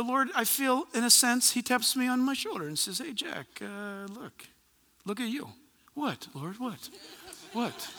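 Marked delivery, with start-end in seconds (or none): 3.0-5.5 hushed, imagined voice of the Lord; gentle tone of correction. Acted. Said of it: "a little bit of a tone to it". 5.9-7.9 very soft, puzzled little replies; sheepish acted questioning. Playing himself answering the Lord.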